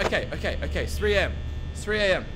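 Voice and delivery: ordinary talk, nothing marked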